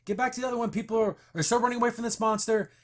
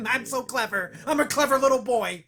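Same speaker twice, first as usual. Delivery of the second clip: smug mocking; 4 semitones higher, 4 dB louder than usual. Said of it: "thought they were so fucking clever".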